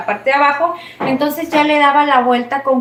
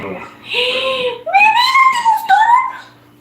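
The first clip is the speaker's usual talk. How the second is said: delighted surprise. Real, not acted. Wife thrilled that her husband liked her cooking, squealing with surprise. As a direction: stretch it out in happy disbelief.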